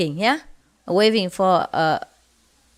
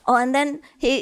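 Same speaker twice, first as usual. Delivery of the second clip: ordinary talk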